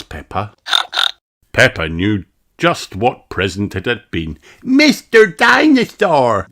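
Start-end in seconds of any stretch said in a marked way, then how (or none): 4.6-6.5 high, louder, slow shout; little girl naming her toy; crying out. A narrator's reading. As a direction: shout the name with joy. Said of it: "she cried".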